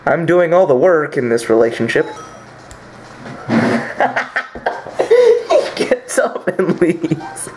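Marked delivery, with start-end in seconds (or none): 3.5-7.5 laughing. Real, not acted. Laughing narration of character.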